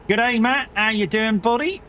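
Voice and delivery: hammed-up Australian "Good day, Matt"; Australian accent. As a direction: greet him in a mock Australian accent. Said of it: "I went all Australian".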